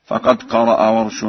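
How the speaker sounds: ordinary talk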